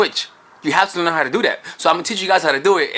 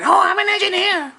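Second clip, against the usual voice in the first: much higher, chattering on; rambling girl. Acted. Woman filling the silence, high and chatty. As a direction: keep talking in a high girl voice.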